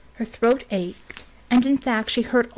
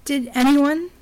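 higher, urgent question ending upward; rising panic; 6 semitones higher, 3 dB louder, slower than usual. A narrator's reading. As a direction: let a touch of panic climb. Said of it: "panic rising to engulf her".

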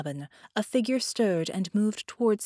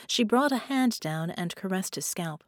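ordinary delivery, nothing marked

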